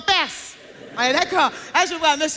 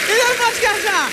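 high exasperated cry; 7 semitones higher, 3 dB louder than usual. Acted. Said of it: "repeated punchline".

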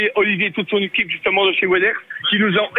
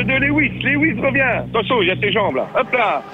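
lively play-by-play, a bit higher; excited sports commentator calling a toy race; race commentary; 5 semitones higher than usual. Acted. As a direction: call the race as it happens.

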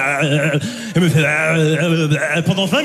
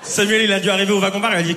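normal talking voice

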